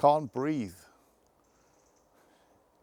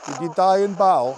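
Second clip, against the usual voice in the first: loud, projected question; calling out; 3 semitones higher, 6 dB louder than usual. Real, not acted. Ghost hunter questions a soldier's spirit, calling out loudly. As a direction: call it out to the unseen.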